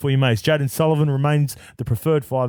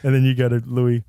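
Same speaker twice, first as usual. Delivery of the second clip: ordinary talk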